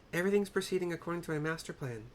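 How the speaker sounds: high, squeaky echo of the line; Nashu voice. Acted. Nashu copies his words into her book, squeaky. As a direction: repeat it up high like a flustered scribe. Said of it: "says Nashu".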